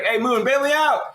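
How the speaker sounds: squeaky comic plea; high pleading plea. Retold or imitated. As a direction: beg the moon for help in a high voice. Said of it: "He's like".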